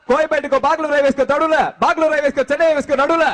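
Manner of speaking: mass hero voice. Acted. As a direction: proclaim like a swaggering star. Mock hero mass dialogue. (பெருமை பேசும் hero குரல்)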